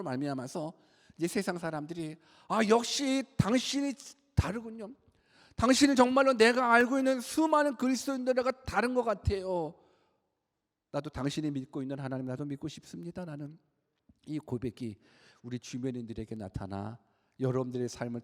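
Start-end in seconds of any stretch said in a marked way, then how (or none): 2.5-13.3 high-pitched, marveling acted quote; admiring realization, pitched up; impressed admiration. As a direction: voice an onlooker won over. Voices admiring people of the world.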